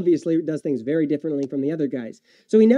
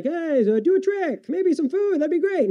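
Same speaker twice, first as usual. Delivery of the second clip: much higher, sneering put-on voice of a demanding crowd; high mocking voice of hungry people wanting a miracle; 13 semitones higher than usual. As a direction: ham up a pushy crowd asking for a trick, pitched way up.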